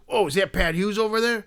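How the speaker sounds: high-pitched, eager dude voice; intense Philly dude. Retold or imitated. Fan noticing who is signing, high-pitched dude impression. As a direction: imitate the guy spotting someone. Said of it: "the impression that I was doing of him".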